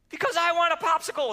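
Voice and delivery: put-on high, loud whiny child's answer; mock whiny child. Daughter insisting on her popsicle, high loud whine. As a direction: whine it like a stubborn five-year-old. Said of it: "started crying all over again".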